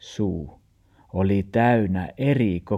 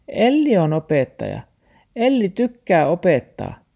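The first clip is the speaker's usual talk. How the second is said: high, loud dog voice; talking dog; 11 semitones higher, 5 dB louder than usual. A narrator's reading. Dog passes teaching job on to Elli, high-voiced. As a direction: protest in a high character voice. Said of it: "sanoo taksi".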